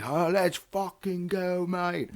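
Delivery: slow, lightly voiced British bloke cheering; a big British guy at the pub cheering, British accent. British accent cheering in a bar. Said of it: "a big British guy's there".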